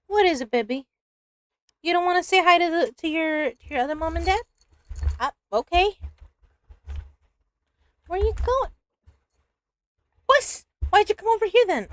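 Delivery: high, soft coo for the cat. Real, not acted. Streamer coos to her cat in a high baby voice.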